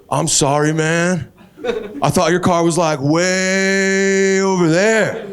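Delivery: a high guy explaining the crash; mock spaced-out apology; stoned guy apologizing. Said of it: "first words out of his mouth".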